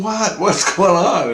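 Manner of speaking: high, loud bewildered outburst; startled disbelief. Retold or imitated. Recalling his shock at the crying, startled disbelief. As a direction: blurt it out, pitch leaping up.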